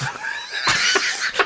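high burst of helpless laughter; shrieking laugh. Real, not acted. Friend bursting out laughing at his own story.

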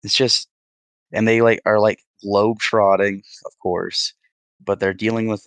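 normal talking voice

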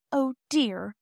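put-on high, mildly dismayed child; a light sigh of worry. A narrator's reading. Child to the cross porter, mildly dismayed sigh. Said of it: "said Bobby with a sigh".

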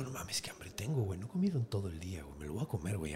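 whispered, fast, to himself; hungry whisper. Acted. Hero eyeing a duckling, whispering to himself. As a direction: mutter your hunger under your breath.

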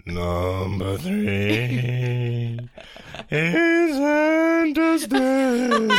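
very high, slow, laughing wail; ghost voice. Pretend. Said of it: "your best ghost voice".

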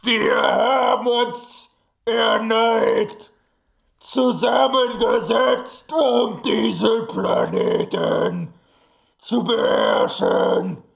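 slow booming villain speech; villain proclamation. Acted. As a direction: proclaim it slowly like a conqueror. Villain proclaims planet conquest.

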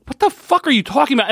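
high-pitched disbelief; incredulous outburst. Real, not acted. Podcaster ranting at a character's logic, incredulous. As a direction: protest it, voice shooting up.

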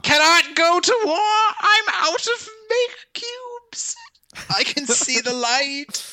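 high, slow, tearful dying voice; having a heart attack. Pretend. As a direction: fade out like a dying hero. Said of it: "fall on the ground and clutch my chest".

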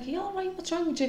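lightly voiced husband checking on her; worried husband. Husband to crying wife at dinner, high, soft worried voice. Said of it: "Carl was like".

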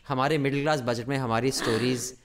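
rattled off quickly; fast Hindi aside. Real, not acted. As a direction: rush through the aside. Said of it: "rapid Hindi aside".

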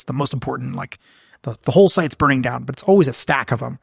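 ordinary delivery

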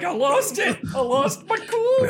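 very high, tearful mock wail. Game master voices lost composure, mock wail.

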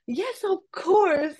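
high, slow forced cheerful yes; bright put-on confidence; fake confidence. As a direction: pretend you're sure of yourself.

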